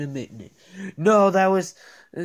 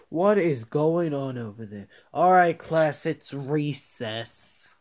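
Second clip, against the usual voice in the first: ordinary talk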